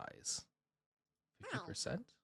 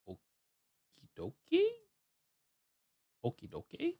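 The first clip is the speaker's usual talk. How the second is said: sing-song; 7 dB quieter than usual. Pretend. Playful filler while setting up.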